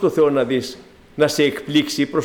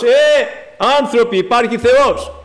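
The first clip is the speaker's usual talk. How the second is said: calling out; 6 semitones higher, 4 dB louder than usual. Retold or imitated. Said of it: "Φωνάζει ο ουρανός".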